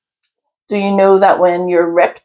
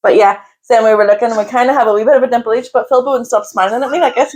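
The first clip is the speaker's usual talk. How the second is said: normal talking voice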